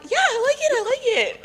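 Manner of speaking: very high, squeaky "I like it"; polite but cornered; mock polite. Mimicking her own polite reply.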